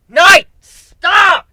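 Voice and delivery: loud, high urgent yell. Acted. Griffin and Aoi yelling at Night to stop, loud. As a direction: scream for him to stop. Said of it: "we both yell".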